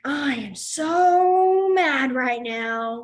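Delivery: very slow, loud, with SO hugely drawn out; acted fury. Shown as a demonstration. Teacher showing students real boiling anger, acted fury. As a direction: seethe in an exaggerated slow build. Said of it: "but you're gonna be like".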